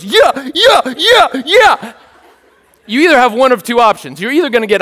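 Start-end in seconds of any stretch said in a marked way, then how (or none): 0.0-1.9 high, repeated yelps of yeah; hammed-up frenzied whooping, bark-like; hyped up. Neighbour on the other side of the fence suddenly yelping, hyped up. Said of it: "because of how hyped I was getting".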